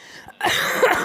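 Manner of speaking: sharp dry cough; real coughing fit. Real, not acted. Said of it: "Please excuse me".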